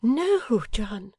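high, protesting wifely cry; high-voiced wife objecting; wife's cry. A narrator's reading. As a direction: cry out to stop him, high and loving. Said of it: "cried Bella".

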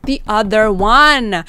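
loud, slow cry; stretched horrified cry; horrified realization. Acted. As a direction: let the dread burst out. Realization hits in horror.

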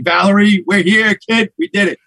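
excited. Real, not acted. Hosts cheer opening first episode.

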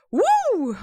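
loud, high burst of energy; a big energetic whoop; excited whoop. Shown as a demonstration. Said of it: "weil ich bin so ein".

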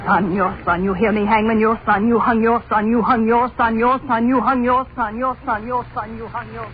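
high chant, fading away at the end; relentless accusation. Acted. Wife accusing the fleeing hangman, relentless and haunting. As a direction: hammer it over and over, then recede into the distance.

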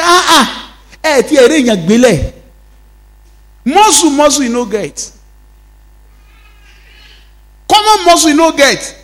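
high, scornful giant taunting in Pidgin; mocking Goliath. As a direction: sneer at the boy's missing muscle. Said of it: "Even the Goliath said it".

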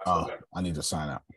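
ordinary talk

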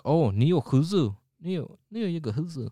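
lightly voiced old Chinese man; a hint of an old uncle. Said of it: "I said in an old man voice".

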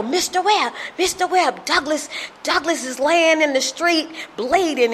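put-on high-pitched, urgent children's voices; imitating alarmed kids. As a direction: mimic kids bringing terrible news. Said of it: "And they go".

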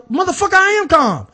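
finally snapping back, hammed-up; furious while insisting on calm; mock angry outburst. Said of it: "And now you're not calm".

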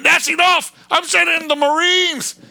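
high, loud commanding quote; mock presidential order; imitating Jefferson. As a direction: bark it like a decisive president. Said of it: "Thomas Jefferson said".

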